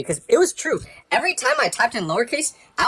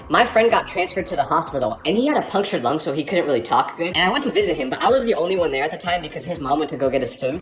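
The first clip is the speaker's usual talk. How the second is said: ordinary delivery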